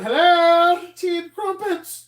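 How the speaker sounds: high, loud, slow parrot chirp; prim parrot voice. Retold or imitated. Corky the parrot greeting, high slow chirpy voice. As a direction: greet like a proper talking parrot. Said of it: "The Corky's going".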